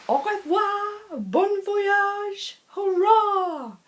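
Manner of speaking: high, slow French farewell cheer; cheery French fries. A narrator's reading. French fries calling out as they sail away, high and cheery. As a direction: wave goodbye in a chirpy French voice.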